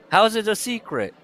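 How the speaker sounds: high, loud bafflement; ranting incredulous questions; exasperated disbelief. Real, not acted. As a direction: demand an answer from the absurd book. Exasperated reaction to the book.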